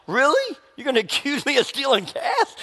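high-pitched disbelieving outrage; mock indignant. Accused man fuming at the car owner, mock indignant. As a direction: play the wrongly accused, incredulous. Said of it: "veins start popping out of my neck".

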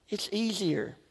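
a hushed confessional echo; quiet repeat. Shown as a demonstration. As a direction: drop the voice on easier like an admission.

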